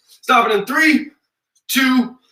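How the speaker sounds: burpy countdown; words broken by burps; talking while burping. Real, not acted. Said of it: "I apologize for the burps".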